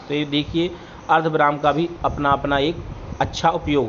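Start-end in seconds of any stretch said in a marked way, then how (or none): none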